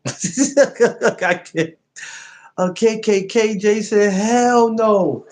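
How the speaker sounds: laughing. Real, not acted. Still laughing at idea.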